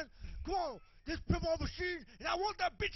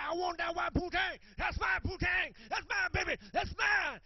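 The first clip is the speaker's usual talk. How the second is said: possessive shouting. Acted. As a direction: yell it out, claiming what's his. Claiming the woman as his.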